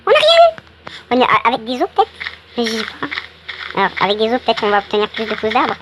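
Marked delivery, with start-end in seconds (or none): 0.0-0.5 very high, loud squeal of dismay; sudden loud high-pitched protest; dismayed outburst. Real, not acted. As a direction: cry out way up high at coming away empty-handed. Finds he got nothing.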